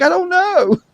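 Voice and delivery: very high put-on voice; very high, clueless put-on voice; mock clueless lady. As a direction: squeak it out helplessly. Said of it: "she's like".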